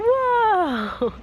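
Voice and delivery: high, loud amazed whoa; delighted whoa. Real, not acted. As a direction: marvel at the little one. Toddler does something impressive.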